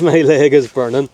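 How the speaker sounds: laughing loudly through the words; laughing as the fire burns. Real, not acted. Fire burning his leg, laughing.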